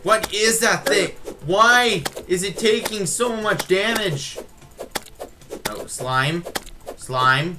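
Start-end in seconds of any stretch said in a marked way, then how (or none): 0.0-4.4 high, slow, panicked; startled by the monster, drawn out; alarmed. Real, not acted.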